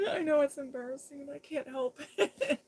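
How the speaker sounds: giggling through the words, voice climbing high; laughing. Real, not acted. To viewers about her torn Bible, laughing. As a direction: laugh at yourself while you explain.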